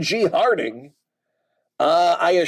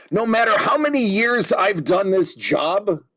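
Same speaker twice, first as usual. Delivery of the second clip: usual speaking voice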